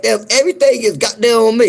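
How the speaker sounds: high, loud mock complaint; a touch of hammed-up exasperation. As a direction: throw your hands up at the lingo. His own exasperated reaction.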